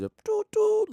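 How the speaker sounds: high train whistle toots. Retold or imitated. Mocking the nagging question, a high train whistle. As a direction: toot like a steam train whistle. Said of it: "like a train".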